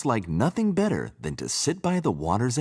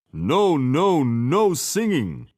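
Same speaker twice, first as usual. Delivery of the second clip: angry big frog; 7 semitones higher, 6 dB louder, slower than usual. A narrator's reading. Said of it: "said the biggest frog".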